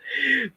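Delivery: short high-pitched laugh; a quick real burst of laughter; amused laugh. Real, not acted. Streamer recalling hilarious movie, high amused laugh. Laughs at the film's theme music.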